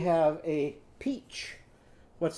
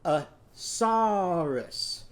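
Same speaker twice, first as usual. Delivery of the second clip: "a saurus" drawn out very slow, sss held; slowly again; 3 semitones higher, much slower than usual. Shown as a demonstration. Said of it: "go down to your knees".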